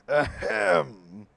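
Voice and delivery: loud, hammed-up ahem; over-the-top throat clear. Old lawyer announcing himself with a loud throat clear. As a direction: clear the throat grandly for show. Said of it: "most over-the-top clear in the throat".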